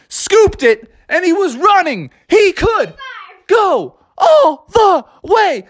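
mock sportscaster narrating the throw; excited play-by-play. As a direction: call the play like a hyped announcer.

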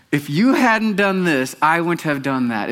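hammed-up self-justifying; defensive husband. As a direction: drag out the excuse like an indignant husband. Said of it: "you ever say that?".

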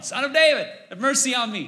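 high, loud imitated plea; yelling. Blind man will not stop calling Jesus, imitated yelling. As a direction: keep calling out as the blind beggar. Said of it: "He keeps yelling".

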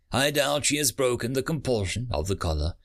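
usual speaking voice

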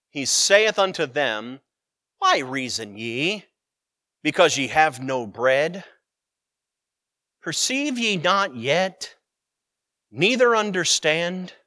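slow, deliberate, weighty reading of the verse; preacher reading the rebuke to the disciples; solemn scripture reading. Acted. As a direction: let each phrase land slowly.

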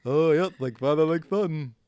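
high, loud put-on dad voice; imitating dad. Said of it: "he's like".

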